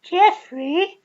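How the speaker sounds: very high, loud, slow flirty tease; mock sing-song come-on; camp teasing. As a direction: coo it playfully up high. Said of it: "And you're like".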